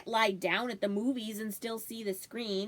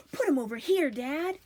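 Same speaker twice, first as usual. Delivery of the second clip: put-on a boy shouting excitedly, higher and louder; 4 semitones higher, 4 dB louder than usual. A narrator's reading. Toby shouting to Dad to bring the bundle over, excitedly. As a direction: call out eagerly to his dad. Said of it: "Toby shouted".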